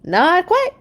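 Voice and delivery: high, loud bear dad voice; Papa Bear voice. Acted. Papa answering Mama, high and loud. Papa's line in read-aloud story.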